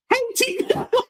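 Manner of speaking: hey, teacups squeaked very high and soft; high, soft, laughing take on hey, teacups; high inflected greeting. Retold or imitated. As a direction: imitate her breathy high hey, teacups. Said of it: "Say it with more inflection in your voice".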